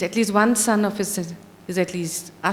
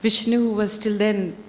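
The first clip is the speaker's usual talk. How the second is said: ordinary delivery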